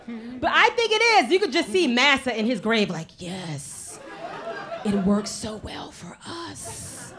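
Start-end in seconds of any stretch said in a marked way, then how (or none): 3.2-7.1 soft, drawn-out relish; gloating. Acted. Imagining Massa gloating in his grave, hushed and gloating. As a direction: savor it quietly, stretching the words.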